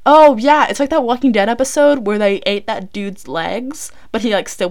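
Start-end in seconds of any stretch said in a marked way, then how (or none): 0.1-0.7 surprised realization. Real, not acted. Sudden realization.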